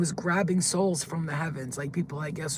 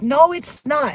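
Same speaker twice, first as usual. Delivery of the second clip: loud, high retort; shouted denial; 10 semitones higher, 11 dB louder, slower than usual. Retold or imitated. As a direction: yell back in a heated argument. Retold screamed argument, talking back.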